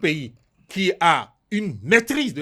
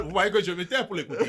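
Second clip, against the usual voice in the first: laughing aside; 3 semitones lower, 9 dB quieter than usual. Real, not acted. Laughing aside about staying quiet.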